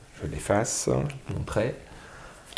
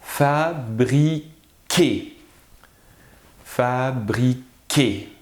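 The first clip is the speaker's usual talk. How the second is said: "fabriquer" split slowly into fa, bri, quer; syllable by syllable; much slower than usual. Shown as a demonstration. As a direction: pause between each syllable of "fabriquer". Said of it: "Et on a la dernière syllabe".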